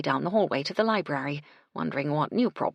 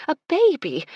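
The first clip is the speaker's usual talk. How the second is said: much higher and louder, bursting with joy; delighted exclamation; 12 semitones higher, 10 dB louder than usual. Acted. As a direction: gasp it out with pure delight. Cecily delighted at the news.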